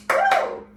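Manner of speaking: high, loud "woo!"; excited whoop. Real, not acted. Teacher celebrating the halfway mark to students, excited whoop. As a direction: let out a high cheer.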